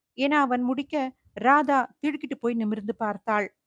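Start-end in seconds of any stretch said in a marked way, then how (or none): none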